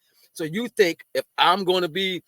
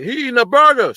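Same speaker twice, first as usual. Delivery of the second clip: loud, higher-pitched mock outcry; louder mock-accusing voice; mock critics; 3 semitones higher, 9 dB louder than usual. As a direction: imitate critics calling him out, loudly. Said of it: "People gonna see this and be like".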